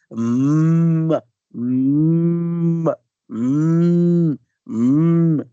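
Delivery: long, loud closed-lip moo; cow mooing hum. Shown as a demonstration. Teacher imitating a cow for the M sound, mooing hum. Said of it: "como do som de uma vaca".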